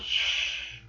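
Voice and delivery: soft imitated shush; hushing shh. Subaru with finger to his mouth, quiet hushing. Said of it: "finger towards his mouth and said".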